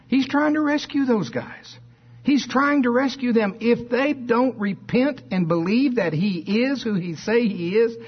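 high-pitched, urgent preaching; impassioned pleading. Real, not acted. Preacher insisting Jesus was rescuing those guys, high impassioned plea.

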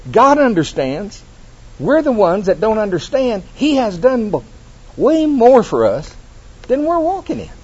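high, loud preaching climb; fiery sermon build, pitched high. Acted. Preaching at full force.

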